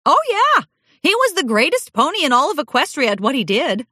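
high, fast and eager; excited gushing. A narrator's reading. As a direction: gush about him proudly. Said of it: "waxing nostalgic".

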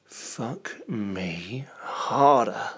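slow, husky whispered plea; drawn-out sultry whisper. Pretend. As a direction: breathe it out slowly. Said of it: "whispered dirty talk".

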